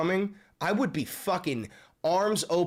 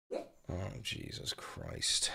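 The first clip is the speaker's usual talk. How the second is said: slow, much lower whispered Jesus Christ; a low, slow, real whisper of dread; whispered dismay; 7 semitones lower, 12 dB quieter, much slower than usual. Real, not acted. Dismayed at more attacks.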